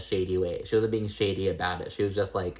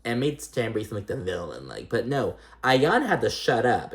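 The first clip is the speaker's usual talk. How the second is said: normal talking voice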